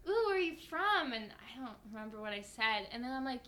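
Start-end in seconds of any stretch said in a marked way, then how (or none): 0.1-1.1 imitating an eager stranger, high and louder; intrigued excitement. Coffee shop staff asking where she's from, excitedly intrigued. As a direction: coo with mock fascination.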